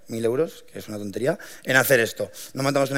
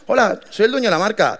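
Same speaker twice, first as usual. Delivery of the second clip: higher, chirpy doorstep hello; proud delivery greeting; 8 semitones higher, 3 dB louder than usual. Retold or imitated. Young founder at a customer's door, chirpy proud greeting. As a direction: beam as you announce yourself.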